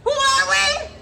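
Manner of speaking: high, shouted question; rallying shout. Real, not acted. Leader calls the crowd to the closing slogan, high rallying shout. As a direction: fire up the crowd for their answer.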